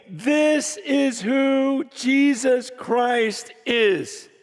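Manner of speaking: slow, weighted declaration; measured and emphatic, like a public introduction; solemn proclamation. Retold or imitated. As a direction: proclaim it word by word. Retold declaration to a crowd.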